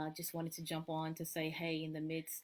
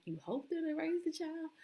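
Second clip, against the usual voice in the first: mock puzzled; 11 semitones higher than usual. Mock puzzled echo of grandma.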